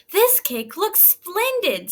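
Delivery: high, proud little hen voice; lightly voiced proud mother hen. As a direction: show off the cake, pleased. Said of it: "said the red hen".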